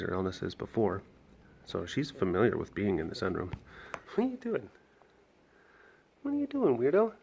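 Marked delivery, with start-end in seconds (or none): 4.1-7.1 much higher, teasing the lemur; high pet-talk voice. Real, not acted. Keeper teasing lemur, high pet voice. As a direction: coo up high at the silly animal.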